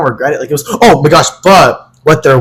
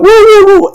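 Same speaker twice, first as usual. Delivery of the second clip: high imitated "woo, woo"; playful party whooping, pitched way up; dancing whoops; 3 dB louder than usual. Imitating own whooping while dancing.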